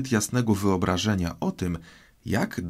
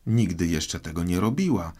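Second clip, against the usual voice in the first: usual speaking voice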